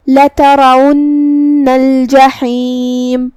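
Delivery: slow, drawn-out melodic tilawat; Quran recitation. Shown as a demonstration. Teacher recites the verse for students, slow melodic chant. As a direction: chant each word long and measured like a qari.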